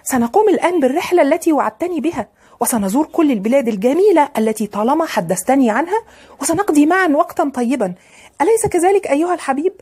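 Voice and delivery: high-pitched, eager chatter to a lover; excited girl coaxing her beloved; eager sweetheart. A narrator's reading. Girl eagerly plans trip with beloved.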